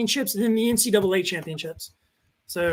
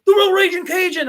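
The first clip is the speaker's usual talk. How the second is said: higher and louder, calling it out like a fan; excited fan; 8 semitones higher, 6 dB louder than usual. Retold or imitated. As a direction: shout the catchphrase at a local celebrity. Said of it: "He goes".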